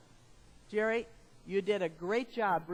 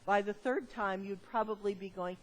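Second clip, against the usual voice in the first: normal talking voice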